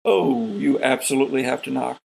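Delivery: usual speaking voice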